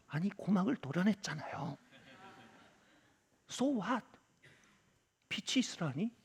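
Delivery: 작은 소리로 속엣말하듯 따지기; 혼잣말처럼 나직하게 반문; 속마음 독백. Retold or imitated. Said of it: "제 마음속으로는". (낮춘 음량의 속마음 반발)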